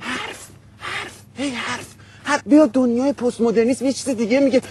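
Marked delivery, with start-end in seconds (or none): 0.0-2.4 very soft, slow, spaced-out repetition; almost whisper each word on its own; hushed mock chatter. Mocking talk with back turned.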